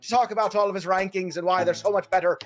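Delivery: a hammed-up Irish accent for the intro; podcast host opening the show in a jokey Irish brogue. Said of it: "how bad was my Irish accent at the top of the show?".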